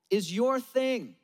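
is YOUR thing, slow with a falling end; stress on your. Acted. Preacher to the congregation, slow stress on YOUR. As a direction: lean on YOUR and let it drop. Repeated refrain.

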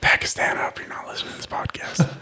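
quick, hushed whisper; mock-secret whisper. Podcaster addressing Pakistan as secret listeners, whispered. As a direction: whisper a joking aside to eavesdroppers.

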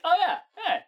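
delighted recognition. Retold or imitated. Retold recognizing the hook again.

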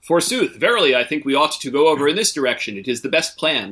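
hammy stage actor. Retold or imitated. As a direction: play it like a pompous Shakespearean. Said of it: "like, written like nineteen-year-olds".

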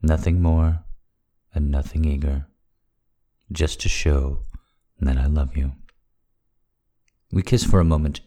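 ordinary delivery

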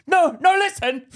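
high, loud, slow mock interruption; shouting down. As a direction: imitate a man barging into the argument. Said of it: "to shout me down".

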